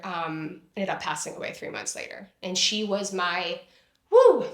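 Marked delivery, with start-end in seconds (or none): 4.1-4.4 choked-back sob exhale. Real, not acted. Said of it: "I didn't wanna cry".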